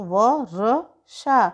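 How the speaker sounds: व र शा spelled out very slowly, one sound at a time; teacher sounding out व र शा; syllable by syllable. Shown as a demonstration. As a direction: break वर्षा into व, र, शा slowly. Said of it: "तो श के ऊपर र की मात्रा".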